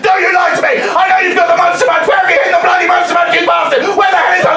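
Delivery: high, loud furious shout; drunk fury. Retold or imitated. Drunk woman screaming at the shop worker about crisps, furious shout.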